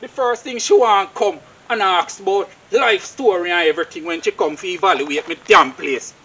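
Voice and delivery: ordinary delivery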